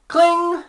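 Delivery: high, loud "cling" sound effect; imitated phone clang. Caller imitates hanging up the old phone, high clanging noise. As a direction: mimic an old phone slamming down.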